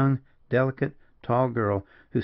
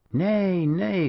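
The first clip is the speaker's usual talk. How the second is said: exclaiming; 8 semitones higher than usual. A narrator's reading. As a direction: gently stop her tears. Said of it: "exclaimed the gallant rover".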